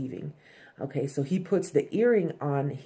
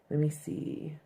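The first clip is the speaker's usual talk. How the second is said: whispered aside; 7 dB quieter than usual. Real, not acted. Checking notes aside.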